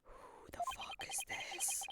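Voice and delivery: hushed whisper of disbelief. Real, not acted. Reacts to strange professor.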